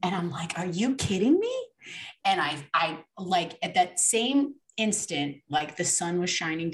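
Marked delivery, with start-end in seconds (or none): 0.6-1.6 high-pitched incredulous question; can't believe it, voice jumping up; retold astonishment. Guest recalls seeing the soap label, astonished.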